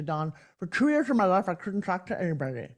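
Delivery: mimicking a tongue thruster, "talk" and "anybody" pushed through the teeth; imitating her childhood tongue-thrust speech. Guest to host, showing how she used to talk, imitating tongue-thrust speech. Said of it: "And that's how I sounded".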